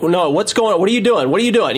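acting out flustered protest; retold panic. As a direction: repeat it with mounting mild alarm. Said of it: "kinda panicking now".

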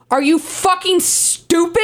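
ordinary delivery

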